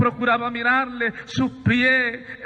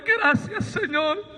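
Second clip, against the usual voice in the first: jumping far higher on Gracias, then falling away; mock cry of thanks; 7 semitones higher, slower than usual. Man thanking the Lord in his vision, high cry. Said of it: "Empecé a gritarle".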